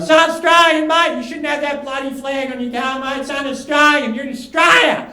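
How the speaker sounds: high-pitched, loud, indignant bikie rant; yelling bikie. Retold or imitated. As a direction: yell it like an outraged Aussie bikie. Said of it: "I heard a bikie yelling".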